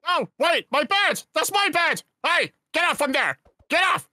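high shouts of outrage; indignant shouting. Acted. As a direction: chase the pig off the bed. Pig jumping on his bed.

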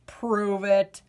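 low, glum potato voice; bored potato. Acted. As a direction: sound like a vegetable with no fun left.

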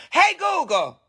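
high, falling call to a voice assistant; calling out to smart speaker. Real, not acted. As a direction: pitch it way up, summon the device.

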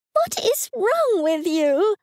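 high, slow, shrill mother's scream; high shrill mum voice. A narrator's reading. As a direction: shriek it at her like an outraged mum. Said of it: "a shrill voice screamed".

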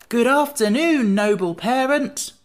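high, loud, drawn-out delighted greeting; delighted cry. A narrator's reading. Jack greets his noble parent, delightedly. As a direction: greet with slow, ringing delight. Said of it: "he cried delightedly".

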